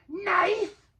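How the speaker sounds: high, loud creature guess; Gollum guess. A narrator's reading. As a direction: guess at last. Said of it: "he said at last".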